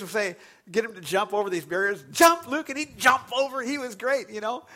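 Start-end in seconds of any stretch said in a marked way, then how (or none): 2.1-2.7 bright, much higher and louder command; calling to a dog. Retold or imitated. Pastor calling his dog to jump barriers, loud and playful. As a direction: call it out to your eager pet.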